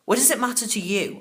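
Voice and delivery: snapping back. Acted. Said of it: "I snapped back".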